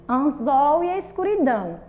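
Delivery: ordinary delivery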